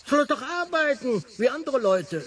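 indignant scoff. Acted. Indignant guest scorns the Major.

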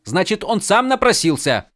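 snapping back. A narrator's reading. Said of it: "огрызнулся Адалин".

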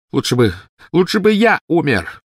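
high, loud anguished wail; despairing cry. A narrator's reading. Snape racked by guilt over Lily's death, crying in despair. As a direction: burst out in despair, voice climbing. Snape wishes he were dead.

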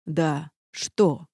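snide castle feigning a question. A narrator's reading. Castle needling the indignant heroine, snide. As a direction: ask back with sly mockery. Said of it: "ехидно переспросил замок".

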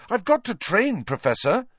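indignant, high-pitched plea; high protest, falling back on the last word; outraged. A narrator's reading. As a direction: plead in outrage with the professor.

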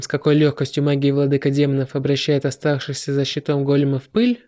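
ordinary delivery, nothing marked

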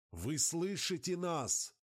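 distant radio voice. Acted. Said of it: "донеслось из эфира".

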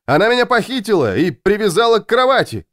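high, swooping exclamation; urgent complaint. A narrator's reading. Husband tells his wife he was kidnapped, urgent complaint. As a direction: blurt out the accusation in alarm.

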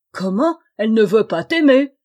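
loud, indignant roar; outraged king. Acted. Wicked king, outraged that the goose-girl refuses, loud. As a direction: thunder in disbelief.

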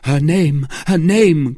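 loud, high, insistent demand; urgent cry. A narrator's reading. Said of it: "cried D'Artagnan".

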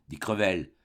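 plain reading voice

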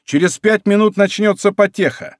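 rally them, voice lifted high; shouted promise. A narrator's reading. D'Artagnan rallying companions mid-chase, high shout. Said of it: "кричал Д'Артаньян".

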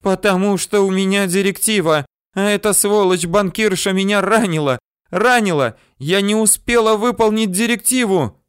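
put-on high, wailing girl's outburst; high-pitched narrated tirade of despair; desperate shouting. A narrator's reading. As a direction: cry it out high and distraught. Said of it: "кричала Йозге".

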